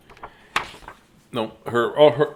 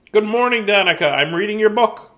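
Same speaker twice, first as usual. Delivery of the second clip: higher, louder cheerful hello; bright greeting; 9 semitones higher, 5 dB louder than usual. Acted. Reader greets Danica while holding the book, bright and high. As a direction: sing out a cheery welcome.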